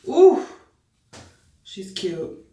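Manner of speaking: put-on light British accent while admiring herself. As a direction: coo it in a mock UK accent. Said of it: "what is up with that accent".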